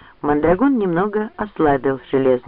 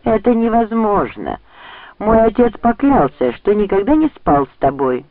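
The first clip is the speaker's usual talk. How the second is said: higher-pitched scornful dismissal; 7 semitones higher, 3 dB louder than usual. A narrator's reading. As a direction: dismiss it with contempt, voice lifted. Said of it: "презрительно рассмеялся". (презрительно, повышенным голосом)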